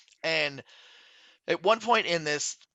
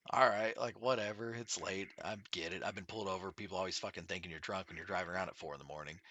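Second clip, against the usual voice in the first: muttered resignation; 8 semitones lower, 11 dB quieter than usual. Retold or imitated. Annoyed narrator quoting himself to cops.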